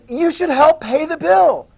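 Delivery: high, loud and slow, a haughty demand; insistent British. Retold or imitated. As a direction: lay down the law to the colonies. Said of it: "the British are like".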